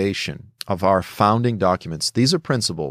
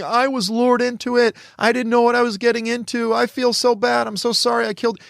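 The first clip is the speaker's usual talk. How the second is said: much higher, mock tearful pleading; imitating crying confession; 12 semitones higher than usual. Captured fighter begging interrogators, imitated in a much higher mock-sobbing voice. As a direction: whimper out a fake-remorseful apology. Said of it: "and they're crying".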